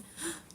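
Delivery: sharp high gasp, soft; mock fearful gasp. Patient on gurney answering the orderly, high frightened gasp. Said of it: "And I said".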